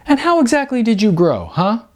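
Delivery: high, pointed challenge; challenging scoff. Acted. Sarah challenging Mitchell, a high scoffing demand. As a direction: demand an answer, sharp and high.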